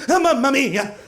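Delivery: hammed-up Italian exclamation; exaggerated Italian accent on Mamma mia; mock Italian. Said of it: "I came and was like".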